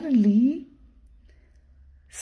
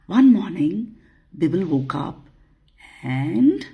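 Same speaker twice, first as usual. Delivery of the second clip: plain reading voice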